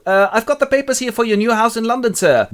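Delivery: high, put-on young clerk voice; polite young Harker. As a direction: play an eager visitor, pitched high. Harker voiced at castle door.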